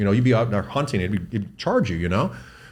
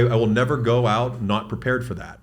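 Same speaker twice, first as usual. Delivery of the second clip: ordinary talk, nothing marked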